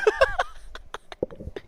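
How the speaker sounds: helpless fading giggle; real laughing fit. Real, not acted. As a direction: laugh until it fades out. Still cracking up, cannot continue.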